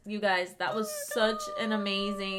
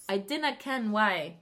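put-on slow playful Scottish accent. Outlander fan gushing about the accents, slow playful Scottish accent. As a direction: drop into a mock Highland lilt. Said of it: "in love with the freaking accents".

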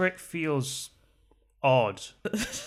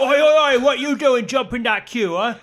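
loud high cockney telling-off; cockney scolding; 8 semitones higher, 11 dB louder than usual. Acted. Guard scolds the queue jumper, loud cockney telling-off. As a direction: tell him off like a London bouncer.

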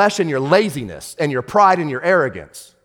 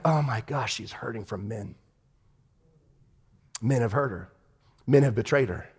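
much softer and lower, almost to yourself; hushed inner thought; 5 semitones lower, 10 dB quieter than usual. Retold or imitated. Pastor recalling his silent thought while praying, hushed. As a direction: murmur it like a private realization.

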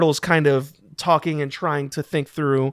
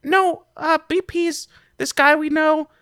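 put-on high-pitched explaining kid voice; kid character; 13 semitones higher than usual. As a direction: explain as an earnest youngster, pitched way up. Game master voices a kid.

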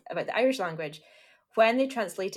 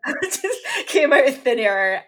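laughing; 7 semitones higher, 10 dB louder, slower than usual. Real, not acted. Sarcastic joke about Harry Potter.

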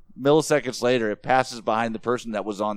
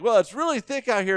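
mock reporter; 9 semitones higher than usual. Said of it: "he's like".

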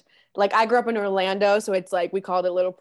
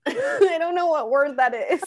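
laughing quote; 7 semitones higher than usual. Retold or imitated. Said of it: "I'm like".

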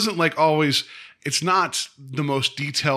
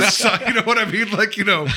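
words bubbling out through a laugh, high; 9 semitones higher, 4 dB louder than usual. Real, not acted. Host laughing at his own joke about farmhouse signs. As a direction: laugh while you talk.

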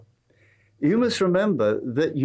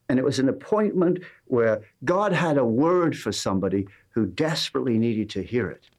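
usual speaking voice

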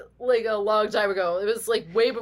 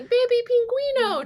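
high-pitched playful Spanish call; mock Spanish yell. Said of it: "yelling Spanish at it".

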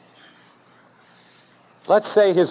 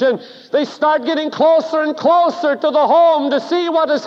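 rising preaching; 9 semitones higher than usual. Acted. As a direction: build the fervour line by line toward the Gadarene. Preacher builds to climax.

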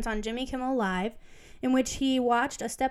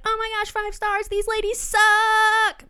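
much higher and louder, drawn out very slowly; mock gushing reviewer; 10 semitones higher, 7 dB louder, slower than usual. A listener writing a five-star review, in a hammed-up squeal. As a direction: ham up a gushing fan who is also insulting. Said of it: "And you can be like".

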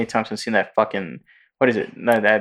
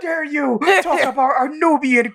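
higher, slow, laughing outrage; mock outraged fan. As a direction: play an offended fan, half laughing. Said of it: "They're like".

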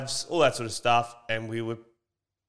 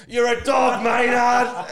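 heckling yell; 10 semitones higher, 6 dB louder, slower than usual. Retold or imitated. Heckling the grandson.

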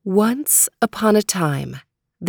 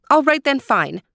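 reluctant princess; 7 semitones higher than usual. Acted. Princess voiced in story.

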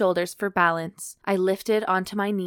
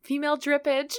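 laughing; 8 semitones higher than usual. Real, not acted. Laughing repeat.

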